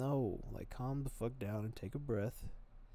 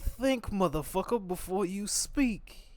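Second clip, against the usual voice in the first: much higher and louder, slow furious warning; dad yelling; 10 semitones higher, 9 dB louder, slower than usual. Retold or imitated. Imitating his dad shouting at him to think, much louder. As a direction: belt it out like a riled-up father. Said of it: "He'd be like".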